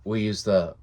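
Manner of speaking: normal talking voice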